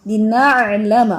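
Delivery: لنعلم slow, ain squeezed from mid-throat; لنعلم drawn out, ain pushed up from the throat; correct ain from throat. Shown as a demonstration. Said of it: "وہ یہی چیز سمجھانا ہے".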